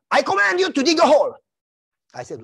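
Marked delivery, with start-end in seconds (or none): 0.1-1.3 loud, high barked order from a captain; captain ordering a soldier to dig, shouted; commanding shout. Retold or imitated. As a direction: shout the command at him in front of the troops.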